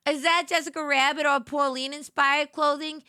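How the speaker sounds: loud, emphatic diva correction; hammed-up offended diva; mock indignation. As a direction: correct them with playful haughtiness. Mock offence at outfit question.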